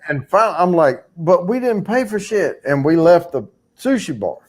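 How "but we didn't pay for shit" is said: higher-pitched mock alarm; retold protest. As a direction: replay your baffled objection. Acts out his alarmed protest.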